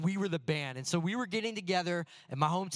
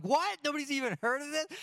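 high, incredulous "what?"; playful exaggerated shock; mock disbelief; 10 semitones higher than usual. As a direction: act stunned that nobody's seen it. Disbelief nobody saw E.T.

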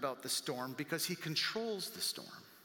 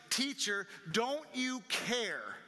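raised, higher-pitched appeal with a drawn-out "care"; mild shout; 6 semitones higher, much slower than usual. Acted. Disciples waking Jesus in the boat, mild shout. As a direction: call out in reproach, only half shouting.

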